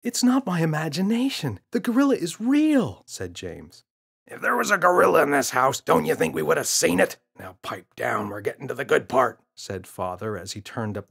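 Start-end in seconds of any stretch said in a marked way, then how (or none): 0.0-3.0 put-on higher, louder kid voice insisting it's real; a frustrated boy pleading to be believed; insistent boy. Boy insisting to his parents. 4.3-9.4 a lightly voiced father brushing off his son; a hint of an impatient dad shushing the kid; dismissive father.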